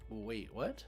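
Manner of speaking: ordinary talk, nothing marked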